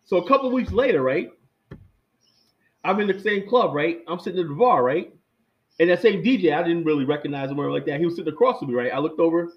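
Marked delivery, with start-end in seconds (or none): none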